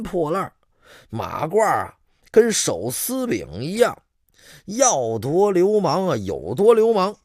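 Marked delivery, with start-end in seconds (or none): none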